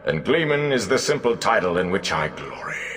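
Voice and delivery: slow, high, grand proclamation; booming bard's voice. Acted. Gleeman proclaims his rank to the villagers, booming grandly. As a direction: declaim as if filling a great hall. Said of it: "voice seemed to reverberate in a great hall".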